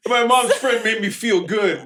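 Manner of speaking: put-on high, slow naive boy voice; mocking Rick. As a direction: act out a clueless kid bragging. Mocking Rick's confession.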